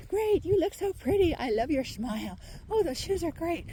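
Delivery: high, bright fake cheer; imitating people who gush to cover things up; mock gushing compliments. Mocking fake friends who pile on compliments, hammed-up cheer.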